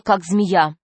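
plain reading voice

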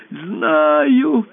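high squeaky little voice; ball squeaking to the emperor, high. Acted. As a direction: squeak it out like a tiny toy. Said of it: "пропищал шар".

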